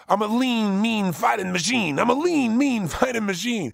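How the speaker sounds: chanted with rising force; incantation demo. Shown as a demonstration. Coach demonstrating a self-talk incantation, chanted. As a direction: chant it like a mantra. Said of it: "you start to create a phrase".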